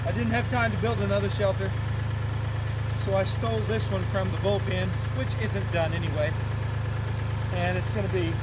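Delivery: panting, loud and breathless; out of breath. Real, not acted. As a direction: talk while still catching your breath. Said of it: "I gotta catch my breath".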